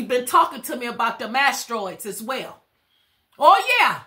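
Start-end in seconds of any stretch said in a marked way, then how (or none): 3.4-4.0 excited exclamation. Real, not acted. Channeling spirits, exclaims in response.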